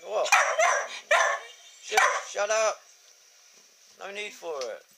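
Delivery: angry shout; yelling at the dog. Real, not acted. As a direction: bark it back at the dog.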